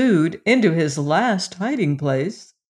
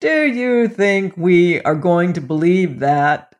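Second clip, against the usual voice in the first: loud, incredulous jailer's retort; scoffing; 5 dB louder than usual. A narrator's reading. Gaolers to the queen below, scoffing. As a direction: throw it back with loud disbelief.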